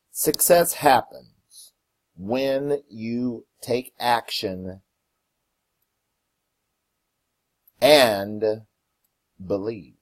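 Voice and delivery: slow reading with long pauses between phrases; slow, deliberate quote. Real, not acted. Host reading the quote of the day to listeners, slow and deliberate. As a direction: read it word by word, letting each phrase land.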